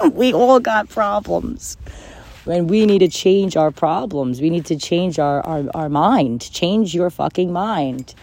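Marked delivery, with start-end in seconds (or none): 0.0-4.4 laughing. Real, not acted. Laughing as she tells listeners we all have problems. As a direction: chuckle out the admission.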